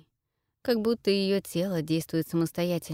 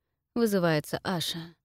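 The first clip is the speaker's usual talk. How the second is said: ordinary delivery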